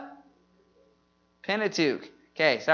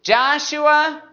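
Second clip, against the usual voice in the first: higher, held-out cue; call-and-response prompt; 6 semitones higher, 4 dB louder than usual. Shown as a demonstration. Teacher says the book for the class to answer, raised prompting call. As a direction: call it out and leave it open. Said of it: "I'll say the book".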